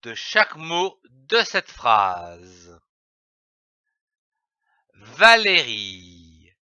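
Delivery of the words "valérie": Valérie drawn out slowly, each syllable clear; slow careful reading. Shown as a demonstration. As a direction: sound out Valérie slowly for a pupil. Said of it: "Commençons par lire les syllabes".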